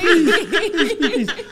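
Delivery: high, loud pleading through laughter; playful begging. Real, not acted. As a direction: beg rapidly, half laughing.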